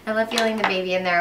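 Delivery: ordinary delivery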